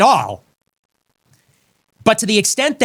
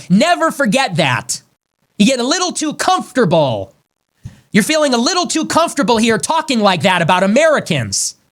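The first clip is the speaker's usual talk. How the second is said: angry raised voice; 6 semitones higher than usual. Real, not acted. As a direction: shout them down with rising fury. Ranting warning at rivals.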